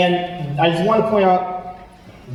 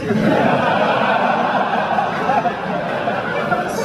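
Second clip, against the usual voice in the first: breathy unvoiced laugh at the memory; real laugh; 8 semitones higher than usual. Real, not acted. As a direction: laugh it off, airy and high. Laughing at eating cereal for months.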